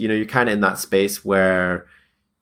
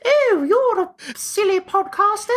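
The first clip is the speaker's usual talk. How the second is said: much higher, slow mock British accent; posh British accent; slower than usual. Host guessing guest's inner voice, mock British accent. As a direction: sing it out in a prissy British accent. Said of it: "like, really British?".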